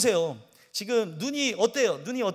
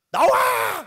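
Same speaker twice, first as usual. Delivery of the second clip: a sudden, much higher and louder bellow of "나와!"; furious shout; 13 semitones higher, 10 dB louder, much slower than usual. Retold or imitated. Angry taxi driver yelling at the young pastor: furious shout. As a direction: roar it like an enraged neighbour at the door.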